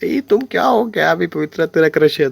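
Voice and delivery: high, teasing imitation of her; lightly mocking high voice; mock Jasmine. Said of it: "वो jasmine बोल रही थी ना".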